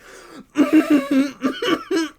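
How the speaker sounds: loud, high, sobbing laugh; shrieky half-crying cackle; mock breakdown laugh. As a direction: crack up as if losing it. Acting his breakdown after customer left.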